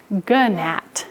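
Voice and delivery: gnat with a hard G-uh before the N; old sounded G. Shown as a demonstration. Teacher showing the old way, gnat said as "guh-nat". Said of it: "we used to say".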